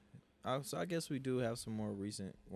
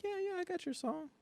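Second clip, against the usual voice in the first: fake cheerful. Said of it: "always gotta smile".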